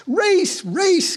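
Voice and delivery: high-pitched chanting call; excited cry. A narrator's reading. Hare demanding a race from the others, high excited cry. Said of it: "cried the hare".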